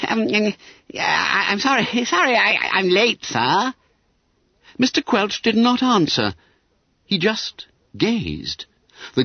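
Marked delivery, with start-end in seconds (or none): 0.1-3.7 high-pitched nervous schoolboy; anxious. A narrator's reading. Bunter apologises to Mister Quelch for lateness, anxious. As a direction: stammer an apology to the master. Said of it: "He blinked anxiously at Mister Quelch".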